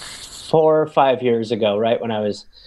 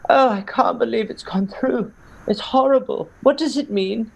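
higher-pitched, hammered mum lamenting; drunk mother; 10 semitones higher than usual. Retold or imitated. Son imitating his hammered mother on Brexit night, drunken high-pitched lament. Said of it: "and she was hammered".

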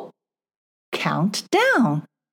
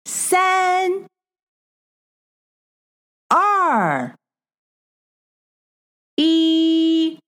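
high sing-song counting; 7 semitones higher, much slower than usual. Acted. Counting down for child learner.

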